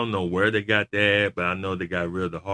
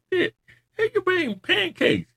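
very high squeaky disbelief; incredulous quote. Retold or imitated. Retelling his reaction again, high mock disbelief. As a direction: squeak it like you can't believe it. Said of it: "And I'm like".

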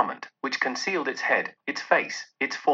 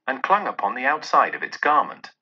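usual speaking voice

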